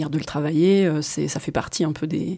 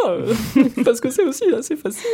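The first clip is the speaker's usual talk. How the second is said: laughing; 8 semitones higher, 3 dB louder, faster than usual. Real, not acted.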